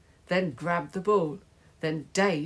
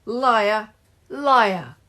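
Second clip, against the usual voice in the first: loud, higher jeer; accusing shout; 5 semitones higher, 8 dB louder than usual. A narrator's reading. Player accusing a rival at the match, loud shout. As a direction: hurl it loudly, word by word.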